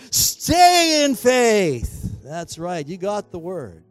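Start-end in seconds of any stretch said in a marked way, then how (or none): 0.1-2.2 very slow, high, loud exhortation; more passion. Shown as a demonstration. Preacher pushing congregation to do better, at full passion. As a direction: stretch STAY out long and high, full of fervor. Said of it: "Come on, you can do better".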